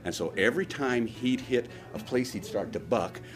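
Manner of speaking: ordinary talk, nothing marked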